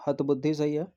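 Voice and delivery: usual speaking voice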